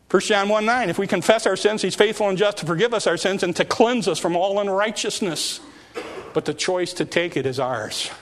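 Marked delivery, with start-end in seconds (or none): 0.1-5.6 loud, raised scripture proclamation; preaching at full force. Real, not acted. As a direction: belt the verse out to the congregation.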